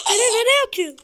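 high, loud panicked cry; being chased. Real, not acted. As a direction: yell in alarm while fleeing. Attacked in game, yells. (kovalanırken panikle bağırarak)

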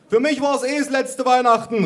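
put-on high, loud old-lady holler; grandma calling out. A narrator's reading. Grandma shrugs off the carbon monoxide, high holler. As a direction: shout it back in high grandma voice. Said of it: "rief meine Oma".

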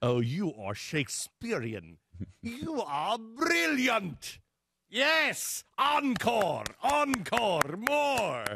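high, slow, drawn-out theatrical gushing; hammed-up applause for a great thespian; mock theatre admirer. Theatregoer hailing a Shakespearean performance, grandly overdone.

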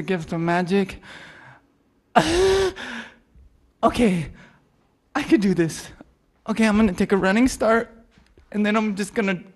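nervous psyching up. Acted.

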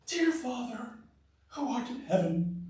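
soft, affected church-prayer voice; put-on pious praying. Preacher imitating someone putting on a show for God, put-on pious. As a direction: imitate a hypocrite's hushed, holy address to God. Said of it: "if you talk like that".

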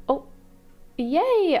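high, loud cheer of delight; excited yay. Real, not acted. Gamer finds first candy cane, cheering loudly. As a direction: cheer like you just won.

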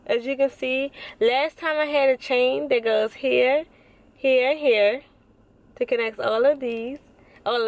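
speaking with lip stretched; finger pulling lip. Real, not acted. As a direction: mouth held open by finger. Seen 2: finger pulls lip corner back to show braces while talking.

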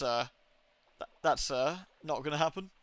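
ordinary talk

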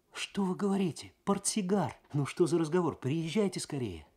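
put-on high, eager young woman; thrilled at the news, urging hurry; excited. A narrator's reading. Svetlana voiced high, excited. (Светлана радостно, голос выше обычного)

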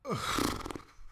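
rough, airy exhale; long breathy sigh; overcome sigh. Real, not acted. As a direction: sigh it out, overwhelmed. Overcome by the scene.

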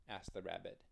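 ordinary delivery

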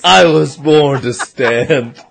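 low, slow heroic declaration; mock-heroic. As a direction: proclaim a destiny, drawn out and low. Mock-epic line as the born stander.

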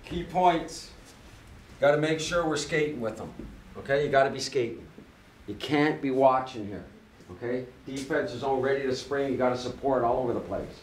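firm coach rallying the team; halftime pep speech. Real, not acted. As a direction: pump the players up. Coach addresses team before game.

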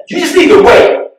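high loud mock yell at a customer; retold yell. Cashier yelling at a customer in line, a loud mock yell. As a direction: bark it like a fed-up cashier. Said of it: "she yelled at me".

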